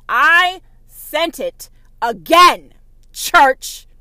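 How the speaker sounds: loud, slow proclamation; booming God voice. Retold or imitated. God rebuking the church, loud slow proclamation. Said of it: "God said".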